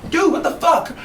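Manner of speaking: panicked driver still reeling from nearly hitting a cop; high mock shriek; shocked driver. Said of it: "She's like".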